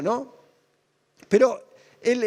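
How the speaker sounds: normal talking voice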